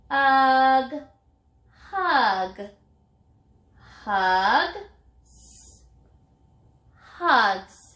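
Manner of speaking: "Ugh", "hug", "hug", a whispered "s-", then "hugs"; sounding out. Shown as a demonstration. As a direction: blend the sounds, hiss the S alone, then say "hugs". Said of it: "I can touch and say, and after I figure out that part, I'll add the S sound".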